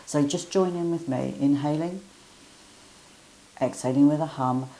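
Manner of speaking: calm guiding voice with hums; guided breathing. Acted. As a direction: lead the humming breath gently. Leads humming bee breath.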